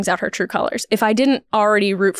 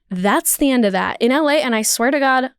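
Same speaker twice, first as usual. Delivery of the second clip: ordinary talk, nothing marked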